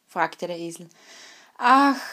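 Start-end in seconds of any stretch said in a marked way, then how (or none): 1.6-2.0 a high, loud woeful "Ach"; lamenting sigh. A narrator's reading. The old dog complains to the donkey, high woeful sigh. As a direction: sigh out the old dog's misery. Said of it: "klagte der Hund".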